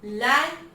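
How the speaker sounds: लैन punched loud as a single beat; isolated syllable. Shown as a demonstration. Teacher breaking transatlantic into sounds, isolated loud syllable लैन. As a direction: hit लैन alone, loud and complete. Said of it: "एक sound complete हो गया".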